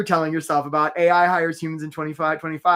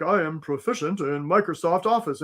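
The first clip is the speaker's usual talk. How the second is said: a hint of a stiff, canned candidate; mock the stuffy resume voice; lightly voiced stilted applicant; stiff formal jobseeker. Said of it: "you don't wanna talk like that".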